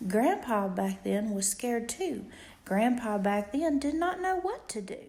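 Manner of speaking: ordinary delivery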